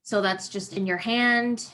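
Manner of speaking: ordinary delivery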